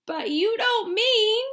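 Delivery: put-on a frightened old man protesting; high slow old grandpa, worried; alarmed Grandpa Joe. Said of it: "shouted Grandpa Joe".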